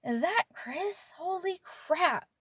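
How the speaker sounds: put-on half-whispered shock at seeing an old face; stunned disbelief. Seeing Chris walk in, a slow hushed stunned murmur. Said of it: "I remember being like".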